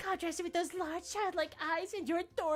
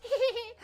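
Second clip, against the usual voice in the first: high, bubbly giggle; cute anime girl giggle; 14 dB louder than usual. Acted. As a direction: giggle girlishly. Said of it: "Your anime girl voice is good".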